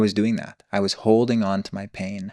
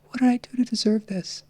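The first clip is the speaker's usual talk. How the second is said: put-on much higher, like a small frightened child; a child's high voice asking what it did wrong; high-pitched kid voice. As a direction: reenact it as the toddler you were, pleading. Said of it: "It's like a kid voice".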